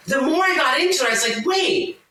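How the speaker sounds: thrilled discovery, voice lifted high; retold amazement. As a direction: replay the moment it clicked, wide-eyed. Retelling her amazed realization.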